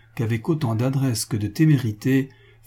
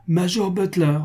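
high, raised summons; calling aloud; 8 semitones higher than usual. A narrator's reading. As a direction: call the officer out of the shadows. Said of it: "dit le prince à haute voix".

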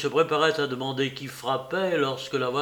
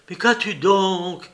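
alarmed, pitched up and louder; distraught; 6 semitones higher, 7 dB louder than usual. Acted. Young man alarmed at seeing his lover's state, éperdu. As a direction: cry out in sudden alarm. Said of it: "fit-il éperdu".

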